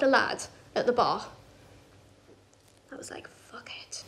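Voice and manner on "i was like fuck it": very soft, voiceless aside; whispered resolve. Retold or imitated. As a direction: whisper it to yourself. Whispered aside in retold bar scene.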